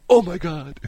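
high, laughing oh my God; a laughing imitation of her dismay; mock shocked gasp. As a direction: act out her embarrassed shock. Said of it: "she goes".

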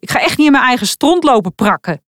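put-on teen son refusing, fed up; indignant outburst. A narrator's reading. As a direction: spit it out while storming off. Son storms out disgusted.

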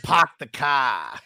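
slow "Park the car" with dropped R, pahk the cah; slow Boston drawl, no R; Boston accent. Retold or imitated. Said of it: "He said".

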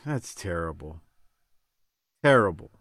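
ordinary talk, nothing marked

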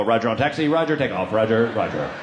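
low, clipped radio-style pilot talk; a low mock military radio voice. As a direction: play the serious pilot, low. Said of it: "if I don't talk like a pilot".

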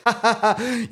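high, breaking Joker laugh. Retold or imitated. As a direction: burst into the Joker's laugh before the joke. Joker laughs after refusing Batman.

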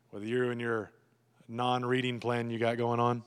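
low, level sarcastic needling. Acted. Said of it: "I can get really sarcastic".

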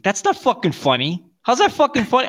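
rising, a mock angry snap; snapping. Angry at the ex's joke, a snapping reply. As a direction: snap back at her, voice climbing. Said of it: "I started snapping again".

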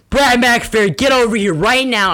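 high, loud scolding teacher call; teacher yelling for Brad to come over. Retold or imitated. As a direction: summon the kid like an angry teacher. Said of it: "then she's like".

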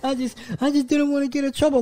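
put-on high, whiny child's excuse; whiny kid. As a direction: play a kid caught lying. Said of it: "be like".

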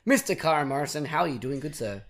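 usual speaking voice

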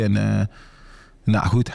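ordinary talk, nothing marked

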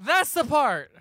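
high, slow, laughing disbelief; laughing squeal. Real, not acted. Laughing at absurd movie plot.

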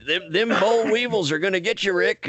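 a hint of a folksy buddy ribbing Rick; imitating a fired-up storyteller. Imitating Wayne telling the story.

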